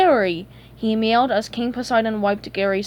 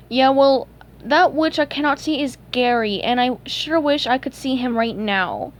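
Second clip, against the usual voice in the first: higher, earnest character voice; SpongeBob, earnest; 3 semitones higher, 3 dB louder than usual. Acted. SpongeBob telling Sage he misses Gary, earnest and higher. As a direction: wish for it in a higher cartoon voice.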